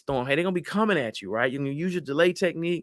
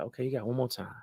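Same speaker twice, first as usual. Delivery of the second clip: lowered, quiet warning; low warning; 6 dB quieter, faster than usual. Acted. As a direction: warn them softly, voice dropped. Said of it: "When people's voice either goes down low".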